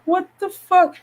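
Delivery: high-pitched, incredulous outburst; retold disbelief. Diner reacting to the infant's temperature check, high incredulous voice. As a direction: squeak it in disbelief.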